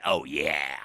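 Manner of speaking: very low, loud mascot bellow; Kool-Aid Man. Retold or imitated. Mascot bursting through the window, booming. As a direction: boom it out as a wall-smashing mascot. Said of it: "through the window like the Kool-Aid man".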